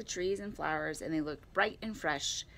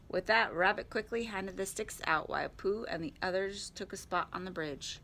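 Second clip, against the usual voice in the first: ordinary delivery, nothing marked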